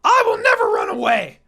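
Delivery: huge high shout, near screaming; loud yell. Acted. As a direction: bellow it as a vow to the room. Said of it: "yelled really loud".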